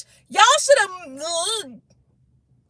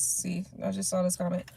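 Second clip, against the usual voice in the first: ordinary talk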